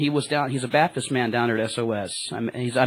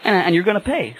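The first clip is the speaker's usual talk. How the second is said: high, louder, laughing tease; joking; 7 semitones higher, 5 dB louder than usual. Retold or imitated. Driver teasing his passenger that he must pay, laughing. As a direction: laugh through the demand for payment. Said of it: "I was, I was joking".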